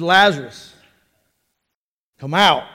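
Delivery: deep, loud command; Jesus shouting. Acted. Preacher as Jesus commands dead Lazarus, deep and loud. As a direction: call out low and loud, in two parts. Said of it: "Jesus shouted".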